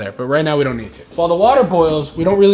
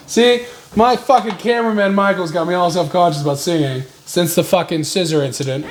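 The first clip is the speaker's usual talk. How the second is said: ordinary talk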